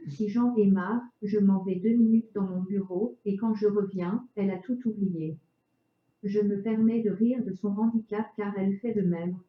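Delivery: chipper text to speech voice; synthetic voice. Real, not acted. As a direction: speak like a computer speech synthesizer. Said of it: "avec une voix de synthèse".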